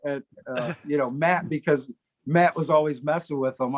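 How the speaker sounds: ordinary talk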